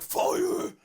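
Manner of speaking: hoarse, loud mock-heroic yell; imitated yell. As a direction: yell the alarm raspily, hammed up. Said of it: "he's yelling out".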